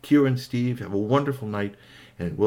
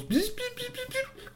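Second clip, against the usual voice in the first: buzzing tool; 8 dB quieter than usual. Acted. Tool buzzing as robot is fixed.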